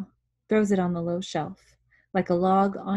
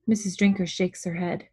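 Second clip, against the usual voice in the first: plain reading voice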